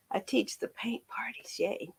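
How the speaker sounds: soft unvoiced whisper of the words. Real, not acted. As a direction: breathe the line out as a hushed whisper. Whispered repeat of the same phrase.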